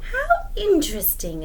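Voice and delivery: high, curious Anansi voice; curious spider. Acted. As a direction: pique of interest, pitched high. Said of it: "Anansi said".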